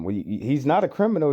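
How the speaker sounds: ordinary talk, nothing marked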